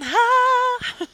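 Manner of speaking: ordinary talk, nothing marked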